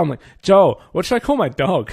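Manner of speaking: normal talking voice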